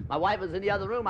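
ordinary delivery

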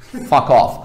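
loud, forceful dismissal. Shown as a demonstration. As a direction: throw it out loud and hard. Two contrasting takes of an insult.

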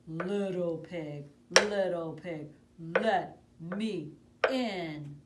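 loud, slow growling wolf at the door; big bad wolf. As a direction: boom it out like the hungry wolf. Wolf voice in told story.

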